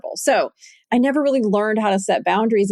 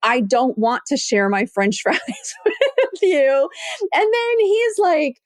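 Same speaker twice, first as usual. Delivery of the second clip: apologetic confession; 5 semitones higher than usual. Retold or imitated. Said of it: "literally say to my husband".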